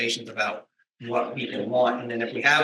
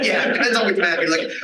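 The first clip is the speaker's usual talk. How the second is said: laughing; 7 semitones higher than usual. Real, not acted. Joking about the wrong map.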